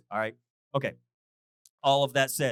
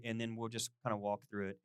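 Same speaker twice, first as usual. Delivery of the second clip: ordinary talk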